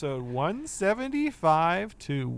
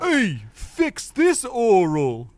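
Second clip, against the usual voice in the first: character voice; 6 semitones higher, 7 dB louder, much slower than usual. Acted. Mocking the Sanji bit.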